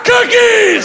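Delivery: very high manic shriek; intense scream. Pretend. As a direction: scream it in wild frenzy. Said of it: "That would have been way more intense".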